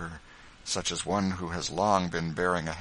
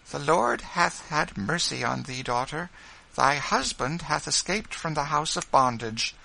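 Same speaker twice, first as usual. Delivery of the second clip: high, earnest woman's voice; earnest, raised motherly tone; motherly Quaker woman; 9 semitones higher, 4 dB louder than usual. A narrator's reading. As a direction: announce a deliverance to your daughter. Rachel gives solemn good news.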